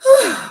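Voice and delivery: a long loud sigh, pitch falling; heavy sigh. Real, not acted. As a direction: let out a big audible sigh. Sighs before moving on.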